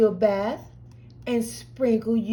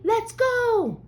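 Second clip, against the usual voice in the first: excited high call; 11 semitones higher than usual. Real, not acted. Cheery launch.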